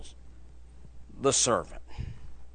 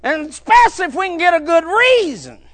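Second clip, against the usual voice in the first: higher and louder, near-shouted sermon climax; preaching at full force; 14 semitones higher, 11 dB louder than usual. Acted. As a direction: drive it home to the congregation.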